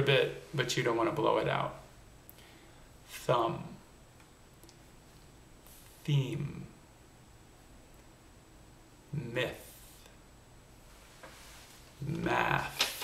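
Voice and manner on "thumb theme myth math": "Thumb", "Theme", "Myth", "Math" with a soft unvoiced th that only flickers a candle; voiceless TH. Shown as a demonstration. Coach modelling voiceless TH words for students, soft and airy. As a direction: breathe out the th gently, no buzz. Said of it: "imagine ... candle in front of your lips".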